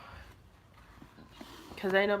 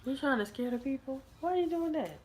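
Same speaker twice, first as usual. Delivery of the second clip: high, teasing reproach; playful scolding; 8 semitones higher than usual. Real, not acted. Mock scolding a prankster child.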